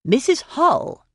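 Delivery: put-on an enthusiastic young man's excitement; excited. A narrator's reading. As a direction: blurt her name with delight. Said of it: "said Weston excitedly".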